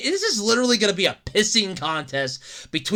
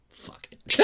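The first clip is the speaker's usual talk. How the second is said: whispered fuck it; 7 dB quieter than usual. Retold or imitated. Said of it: "and I was like".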